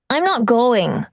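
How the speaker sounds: put-on a small boy digging in his heels; child declaring. Boy refusing to leave home, stubbornly declares. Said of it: "Dante declares".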